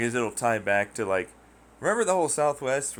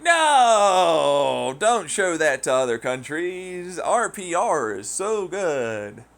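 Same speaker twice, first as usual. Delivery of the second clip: high, loud and slow pleading; overdone panic about PR; mock American plea; 7 semitones higher, 8 dB louder, much slower than usual. Americans begging to hide it, slow mock plea.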